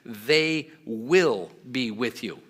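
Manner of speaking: slow, with WILL pressed hard; a slow, emphatic promise on will. Shown as a demonstration. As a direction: lean on will, word by word. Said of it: "It's emphatic".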